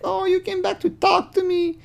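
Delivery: very high, fake-sweet falsetto; mock her sugary tone; high artificial girl voice. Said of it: "Again, very artificial".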